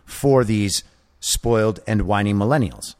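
normal talking voice

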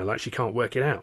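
usual speaking voice

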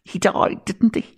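high, gushing TV interviewer fishing for tears; milk the guest's grief like a chat-show host; Miriam O'Callaghan impression. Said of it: "where I'm Miriam O'Callaghan".

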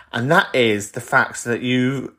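ordinary talk

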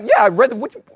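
1 cheerful reply. Retold or imitated. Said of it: "It's like".

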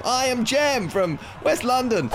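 shouted high-pitched challenge; samurai battle cry. Shown as a demonstration. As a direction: yell your name across the battlefield. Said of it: "as you're standing there going".

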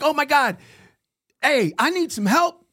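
high, loud flustered call for help; panicked excitement. Retold or imitated. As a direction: blurt it out to the sales guys. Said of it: "And I was like".

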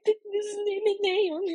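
high, slow mock sob; retold hysterical sob. Telling the host about the snail, high slow mock sobbing. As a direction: whimper it out like a tearful pregnant wife. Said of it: "I was hysterical".

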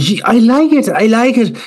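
high, gushing judge voice; mock Louis Walsh. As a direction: gush at the wallpaper like a star judge. Louis Walsh praising the car.

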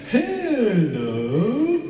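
high, drawn-out sing-song hello; slow kids-TV clown greeting; Mister Tumble clown voice. Retold or imitated. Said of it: "do the impression of Mister Tumble".

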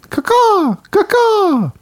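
high cawing crow call. Acted. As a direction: caw like a crow, twice.

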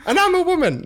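very high mock-female falsetto; badly faked lady voice; man posing as woman. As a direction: insist in a silly falsetto. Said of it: "men were dressing up as women".

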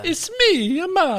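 video game Mario. Retold or imitated. Friend jokes back with the game Mario voice, high and cartoonish. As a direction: squeak it out like the game's Mario. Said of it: "it doesn't talk like that".

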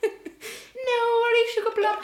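put-on very high, drawn-out baby talk, half laughing; hammed-up baby voice. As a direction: coo sweet nothings in an exaggerated baby voice. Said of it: "people who talk in baby voices".

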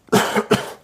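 short dry cough; a sick, unvoiced cough; real cough. Real, not acted. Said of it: "Pardon, je suis un peu malade". (toux sèche de malade)

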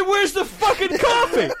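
high, slow, laughing outrage; outraged laughing demand. Retold or imitated. Customer to worker, laughing outraged demand. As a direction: demand the coffee through laughter.

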